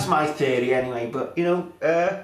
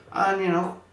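ordinary talk, nothing marked

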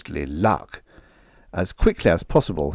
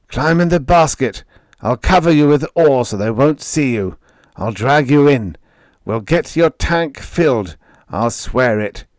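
urgent, higher and louder; 6 semitones higher, 4 dB louder than usual. A narrator's reading. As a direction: press him to hurry, high and loud. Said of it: "Kalba's voice, faint, urgent".

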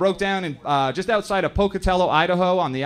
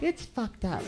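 put-on high, small child's voice; a three-year-old copying a grown-up; toddler voice; 7 semitones higher, 7 dB quieter, slower than usual. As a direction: parrot it like a tiny kid. Three-year-old son parroting.

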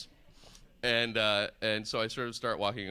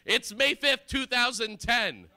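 very high, loud hotel wake-up shout; mock alarmed announcement; 13 semitones higher, 8 dB louder, slower than usual. Hotel caller waking a guest, mock urgent shout. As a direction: blare it out, stretching the end.

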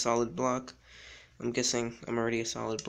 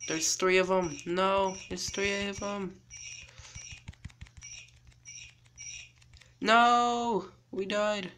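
panicked shouting; 7 semitones higher, slower than usual. Real, not acted. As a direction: panic as the hunters close in. Hunters find him, he dies.

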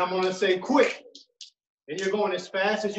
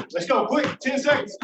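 higher, urgent coaching call; 6 semitones higher, 4 dB louder than usual. Real, not acted. Coach pushing players to go quick for ten seconds, urgent call-out. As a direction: call out to push them through the drill.

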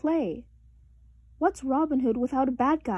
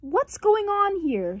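shouting over the noise; 8 semitones higher, slower than usual. A narrator's reading. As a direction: shout to be heard over kids. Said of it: "shouted Papa".

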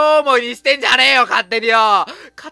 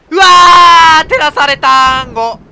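loud startled shout; startled cry; 4 semitones higher, 10 dB louder, slower than usual. Real, not acted. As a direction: cry out in shock. Lights flash in dark.

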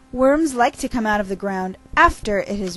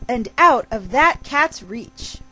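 ordinary delivery, nothing marked

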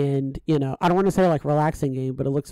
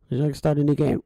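ordinary talk

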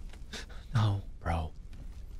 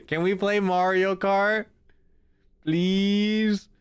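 ordinary talk

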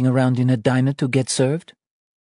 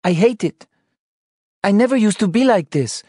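much higher, louder frightened wife; scared crying; 14 semitones higher, 4 dB louder than usual. Acted. Wife confessing her fear of motherhood, tearful and scared. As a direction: pour out fear through tears. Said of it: "She cried".

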